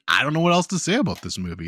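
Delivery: ordinary talk, nothing marked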